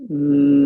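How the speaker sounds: humming sigh on the exhale; audible sigh. Shown as a demonstration. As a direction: sigh it out with a hum. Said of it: "And then sigh for seven".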